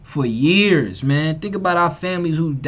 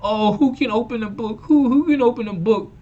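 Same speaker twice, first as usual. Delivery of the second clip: higher, mocking whine of the Bible figure; mocking scripture voice; 6 semitones higher than usual. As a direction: lampoon the verse in a silly whine. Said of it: "you be like".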